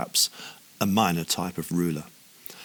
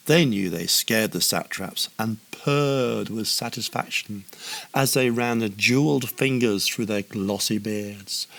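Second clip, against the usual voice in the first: plain reading voice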